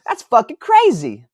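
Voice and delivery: loud, high amazed cursing; excited disbelief. Real, not acted. Author amazed by his numbers, loud excited disbelief. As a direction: marvel at it out loud. Reacting to his sales total.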